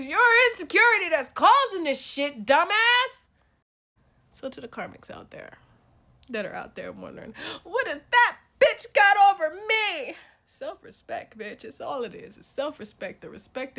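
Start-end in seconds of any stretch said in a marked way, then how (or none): 0.0-3.3 loud, high telling-off; rising, heated outburst; exasperated scolding. Acted. Scolding the men. 7.6-10.2 high, loud, slow imitation of a jilted woman; drawn-out fake-crying complaint; mock wail. Voicing jealous women wondering.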